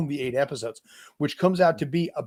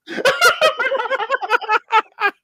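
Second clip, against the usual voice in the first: high laugh; 6 dB louder than usual. Real, not acted. Laughing at terrible elf voice.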